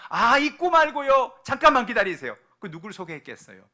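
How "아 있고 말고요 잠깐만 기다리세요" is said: eager reply. Acted. Miriam answers the princess.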